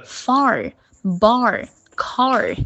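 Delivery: far, bar, car each ending in a clear r; r-coloured endings. Shown as a demonstration. Teacher modelling the correct way, far, bar, car with r sounded. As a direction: curl the tongue at the end of far, bar, car. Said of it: "这样子".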